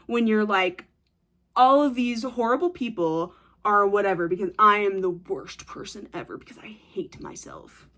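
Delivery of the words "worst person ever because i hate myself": a whispered parody of self-loathing; mocking whisper. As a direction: whisper it, much softer.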